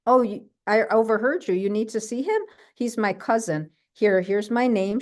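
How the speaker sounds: playing the helpful waitress; waitress butting in, mildly eager. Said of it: "the waitress comes over and she goes".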